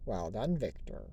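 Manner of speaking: low sly villain purr. Pretend. Redoing the voice for viewers, deep sly villain. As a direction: relish it like a scheming villain. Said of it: "I'll do it one more time".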